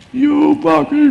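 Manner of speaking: loud, high, slow mock yell at someone; mock shout. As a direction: bark it out like a nobody turned furious. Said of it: "can suddenly start shouting".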